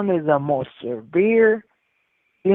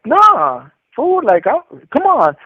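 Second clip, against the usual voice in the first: high, acted dismissive protest; protesting brush-off; 8 semitones higher, 5 dB louder, slower than usual. Younger homie answers older homie's scolding, high protesting brush-off. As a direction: wave off the scolding, retold.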